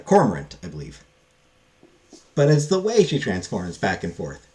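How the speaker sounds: usual speaking voice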